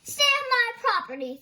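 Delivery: high, loud angry yell. Acted. Thorndyke yelling at Nelson to stay off his property, loud and high. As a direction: bellow at the trespasser. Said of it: "Thorndyke yelled".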